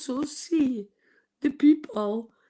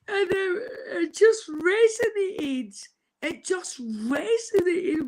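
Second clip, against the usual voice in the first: crying; 7 semitones higher than usual. Real, not acted. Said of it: "I'm crying my heart out".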